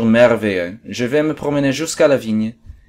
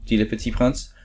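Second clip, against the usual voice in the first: ordinary delivery, nothing marked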